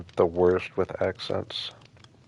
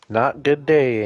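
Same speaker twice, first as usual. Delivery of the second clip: day said with a falling end; Not good day, stressing day; day leaned on and dropped; 4 semitones higher, 7 dB louder, slower than usual. Shown as a demonstration. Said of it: "I know it sounds like good day to you".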